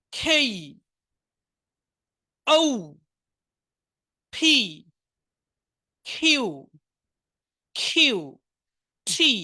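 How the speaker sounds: loud, deliberate recitation of tricky letters; hard letters drilled. Shown as a demonstration. Teacher repeating the difficult letters for students. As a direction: stamp each hard letter clearly. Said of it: "কঠিন উচ্চারণগুলো আরেকবার বলে দিচ্ছি".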